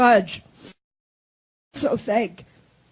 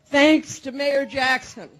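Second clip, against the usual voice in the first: normal talking voice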